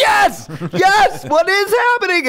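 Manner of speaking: very high, loud gleeful exclaiming; retold giddy disbelief, pitch soaring; acted elation. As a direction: cheer on the chaos, hammed up. Acts own thrill at the fight.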